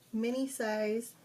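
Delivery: normal talking voice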